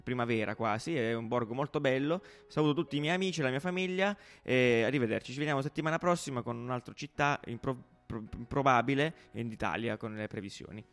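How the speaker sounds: playful weather presenter voice; weather forecast read. Acted. Mock weather forecast segment.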